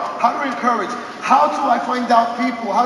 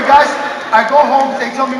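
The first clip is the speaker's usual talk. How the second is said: ordinary talk, nothing marked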